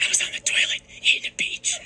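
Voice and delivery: unvoiced whisper through a laugh. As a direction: whisper the silly truth. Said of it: "why do you have to whisper about it".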